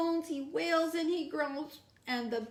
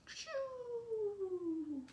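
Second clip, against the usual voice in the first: soft shushing snore, higher and softer; breathy snore sound; 8 semitones higher, 11 dB quieter than usual. Shown as a demonstration. Reader makes the sleeping bear's snore for the child. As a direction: let out a long sleepy snore. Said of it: "Where's our bear snoring sound?".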